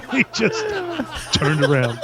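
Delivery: real helpless laughter through the words, slow and soft; cracking up at your own story; laughing while talking. Real, not acted.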